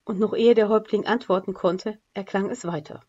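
plain reading voice

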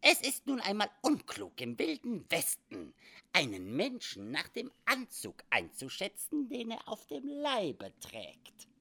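put-on high, odd old trapper voice; squeaky wry frontier voice; quirky old trapper. Sam Hawkins speaking in story.

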